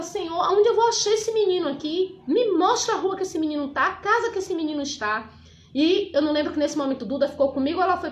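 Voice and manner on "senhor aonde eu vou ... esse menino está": reenacted high, urgent plea to God; imitating an anxious prayer; pleading prayer. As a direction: beg God for directions, voice raised in pitch. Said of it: "comecei a orar, perguntar".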